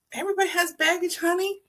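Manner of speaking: high, fast, sassy put-down; fast, high mock-diva comeback; sassy retort. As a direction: toss it off with sass, honey. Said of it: "I'm like".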